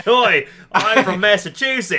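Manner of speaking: put-on loud, high, drawn-out Australian accent; mock Australian accent. Co-host teasing friend's failed Boston attempt, loud mock Australian accent. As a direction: belt out a hammed-up Australian accent. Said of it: "turned into an Australian accent".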